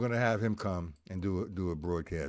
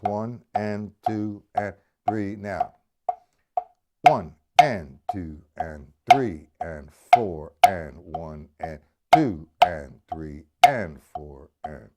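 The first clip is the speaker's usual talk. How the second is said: slow, steady, louder count, each number spaced out; slow counted beat; 4 semitones lower, 6 dB louder, slower than usual. Shown as a demonstration. Teacher counting eighth notes aloud for his student, slow and steady. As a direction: count it out slowly and firmly, like keeping time on the drum. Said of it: "Nice and slow".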